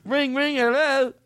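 high-pitched Cockney copycat; mock Cockney. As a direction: parrot the phone bit in exaggerated Cockney. Copies the Cockney phone bit.

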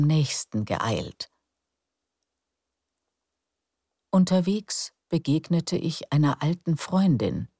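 calm, unhurried storyteller; bedtime story narration. A narrator's reading. (ruhige Gutenachtgeschichte)

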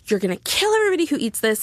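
flipping out, imitating an angry scold; worked-up retold accusation; retold freak-out. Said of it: "flipping the fuck out at me".

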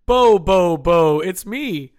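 loud, drawn-out Santa announcement; Bo Jackson as Santa. Acted. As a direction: boom out the ho-ho-ho, then stretch the boast. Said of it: "Bo Jackson as Santa Claus".

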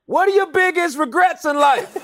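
high-pitched man-on-the-street question; punchy mock interviewer delivery. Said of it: "and I say".

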